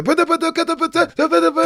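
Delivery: very high, rapid hyped-up rap chant. Retold or imitated. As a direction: fire off the words like a hype rapper. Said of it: "but just the hype".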